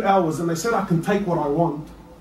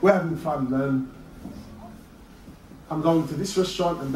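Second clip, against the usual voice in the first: lower, softer lazy mock of partygoers; mock carefree; 5 semitones lower, 6 dB quieter than usual. Preacher imitating carefree party-goers, lower and softer. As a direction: lounge through it like a careless partygoer. Mocks party-going Ramadan.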